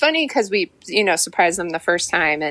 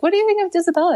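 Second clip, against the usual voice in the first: bright teasing question; 12 semitones higher, much faster than usual. Retold or imitated. Teasing the mom with a joke name.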